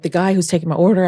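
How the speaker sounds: ordinary talk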